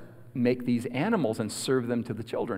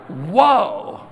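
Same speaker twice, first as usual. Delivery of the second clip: higher, louder burst of amazement; awed whoa; 6 semitones higher, 6 dB louder than usual. Retold or imitated. Small boy gaping at grandma's undies, awed. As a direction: let the whoa burst out in awe. Child astonished at grandma's size.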